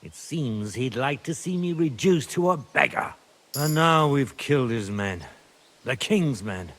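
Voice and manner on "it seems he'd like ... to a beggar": ordinary delivery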